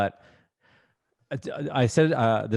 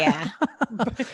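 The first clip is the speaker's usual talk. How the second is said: high laugh; 13 semitones higher than usual. Real, not acted. Cohost laughs at flapper bit.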